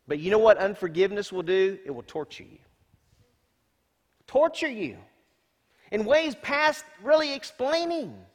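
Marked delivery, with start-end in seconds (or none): 4.3-8.2 high, slow, pressing; preacher rising to a pleading peak; emphatic high warning. Real, not acted. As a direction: warn them it will hurt.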